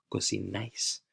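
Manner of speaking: cocinais repeated softly, final "nais" stressed; soft model of cocinais, weight on NAIS; stress on last syllable. Shown as a demonstration. Teacher repeating a tricky word to pronounce, softly stressing the end of cocinais. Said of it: "The stress is on that syllable".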